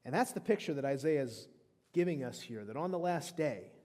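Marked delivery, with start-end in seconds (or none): none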